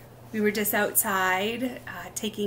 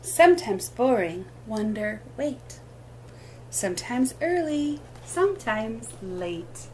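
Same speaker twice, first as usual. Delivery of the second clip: sing-song picture-book reading, lilting rhythm; reading to children; slower than usual. Acted. Teacher reading rhyming book aloud at storytime, lilting. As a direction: read each line like a gentle rhyme for little ones.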